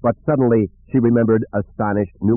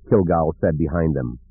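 ordinary delivery

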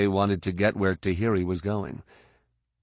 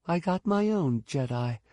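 Mirta; 8 semitones higher than usual. Acted. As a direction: answer in a high voice. Mirta answers Fett.

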